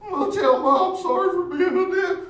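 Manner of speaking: put-on high, loud whining kid voice; mock whiny apology. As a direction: whine it like a tattling child. Buddy voiced, hammed-up apology.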